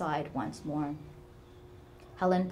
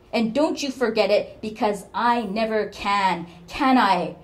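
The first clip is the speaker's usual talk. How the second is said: louder and higher, cutting; bitter reproach; 4 semitones higher, 10 dB louder than usual. Acted. Mother lays guilt on daughter.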